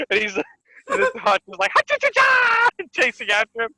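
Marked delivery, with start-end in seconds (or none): 1.7-2.7 very high staccato cartoon squeal; mock excited battle noise, rising high; imitated gleeful cry. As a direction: squeal it like a frolicking cartoon giant.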